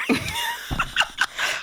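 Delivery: high burst of laughter. Real, not acted.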